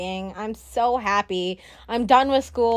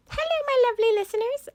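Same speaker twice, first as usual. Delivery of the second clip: high announcer greeting; 13 semitones higher than usual. Pretend. Mock grand show greeting.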